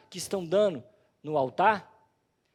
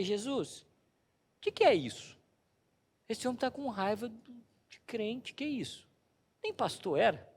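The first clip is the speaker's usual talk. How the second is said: hushed prayer; 2 semitones higher, 6 dB quieter than usual. Retold or imitated. His inner prayer.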